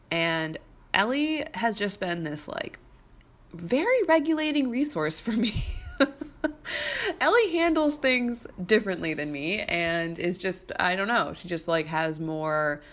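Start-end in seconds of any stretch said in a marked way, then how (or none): 5.7-7.2 a high, airy laugh trailing off. Real, not acted. Laughing about her regulating neighbor.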